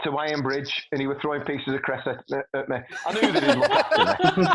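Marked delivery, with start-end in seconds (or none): 3.0-4.6 high, loud laughing whine; mock plea, laughing high and loud; laughing squeal. Mock whining about friend.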